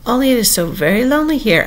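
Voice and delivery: high, plaintive lonely lament; melancholy. A narrator's reading. Alice alone in the wood with the Queen, melancholy. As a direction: let loneliness lift and droop the voice. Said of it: "said in a melancholy voice".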